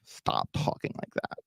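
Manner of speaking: hushed, breathy whisper; whispered stop. Retold or imitated. Quoting his partner Jada, whispered plea to stop. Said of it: "Jada's like".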